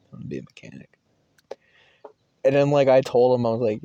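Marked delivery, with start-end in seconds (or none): 0.1-1.0 very soft, low repeat; retold answer, sunk to a murmur; low mumble. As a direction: mumble it under the breath. Repeats answer under his breath.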